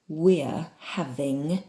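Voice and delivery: slow, word-by-word articulation; very clear enunciation. Shown as a demonstration. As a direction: pronounce every sound slowly and carefully. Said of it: "letters very clearly".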